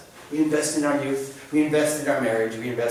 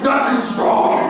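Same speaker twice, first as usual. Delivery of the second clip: mock sulky denial; 11 semitones higher, 7 dB louder, much slower than usual. Husband sulking at wife.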